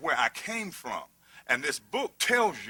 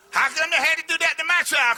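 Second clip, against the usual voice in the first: mock complaining parent; 11 semitones higher, 6 dB louder, faster than usual.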